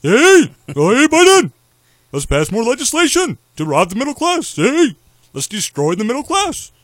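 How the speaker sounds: high, loud cartoon-bear president; hammed-up presidential impression, voice soaring high; Barack Hussein Obama. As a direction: holler it like a goofy cartoon politician. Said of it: "he sounds like Yogi Bear".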